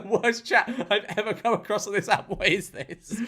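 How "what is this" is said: usual speaking voice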